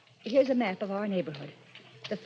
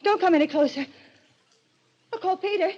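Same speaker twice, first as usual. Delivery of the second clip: loud, high keep-away cry; terrified warning; 8 semitones higher, 4 dB louder than usual. Acted. Kate warning Quentin off, loud and terrified. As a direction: push him back with fear. Confronting the intruder.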